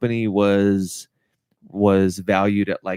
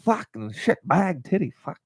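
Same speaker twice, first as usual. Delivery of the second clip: higher mock outburst in a put-on voice; imitating an exclaimer; 5 semitones higher, 4 dB quieter than usual. Friend mimics guys who swear in a funny voice, higher and mocking. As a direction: blurt it like the shock-humor guy. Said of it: "speaking of guys who talk like that".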